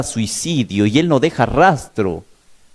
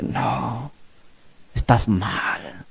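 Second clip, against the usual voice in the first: whispered, much softer; 3 semitones lower, 9 dB quieter, much slower than usual. Retold or imitated. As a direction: whisper it low and firm. (susurro bajo y firme)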